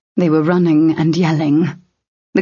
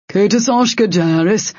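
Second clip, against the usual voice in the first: grim pronouncement in a put-on voice; 3 semitones higher than usual. A narrator's reading. Sword's power answers Locke about the crystal, grimly. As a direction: state it grimly, as a solemn verdict. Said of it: "Gil's power said grimly".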